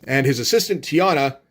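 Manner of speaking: normal talking voice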